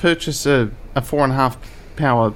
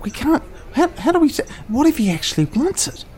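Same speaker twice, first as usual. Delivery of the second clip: high panicky; 13 semitones higher than usual. Acted.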